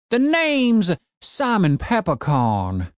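put-on high, slow grumpy old codger; cranky old man. A narrator's reading. Old man ordering his broken zombie gardener, gruff old voice.

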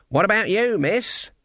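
high, put-on questioning voice; beggar woman act. A narrator's reading. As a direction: ask it high, as the harmless old woman. Still posing as beggar woman.

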